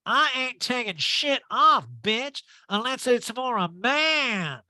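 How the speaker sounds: high, slow put-on diva voice; mock Erica refusing to undress; sassy Erica voice. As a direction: drawl it out high with attitude.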